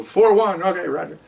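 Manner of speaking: high, sing-out roll call; sergeant calling roll. Retold or imitated.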